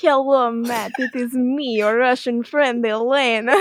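comic fake Russian accent; mock Russian accent. Elena phoning Matt as Russian friend, mock Russian accent. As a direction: play it as a deliberately hokey Russian accent. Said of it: "a Russian bit between Elena and".

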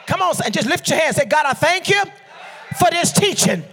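rally the congregation, building to a shout; full-force preaching. Real, not acted. Preacher leads congregation in thanks for teaching, building to a shout.

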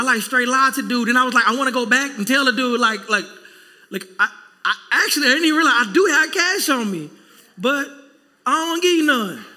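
usual speaking voice

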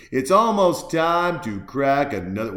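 loud, emphatic repeat with stress on "almost". Shown as a demonstration. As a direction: boom out "almost time to crack another". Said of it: "And it's almost time to crack another beer".

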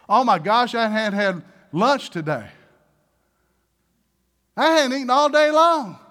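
loud, hammed-up whining protest; mock hungry complaint. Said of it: "What does your senses start telling you?".

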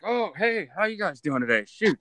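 high, hammed-up surprise at being caught napping; cheerful fake wake-up greeting to the gawking visitors; mock waking up. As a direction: play it like you just startled awake. Acting himself waking in enclosure.

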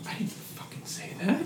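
grandma's hushed secret protest; indignant whisper. Retold or imitated. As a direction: whisper the denial under your breath. Grandma whispers back at him.

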